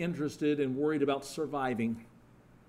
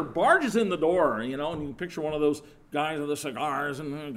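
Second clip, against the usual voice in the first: ordinary delivery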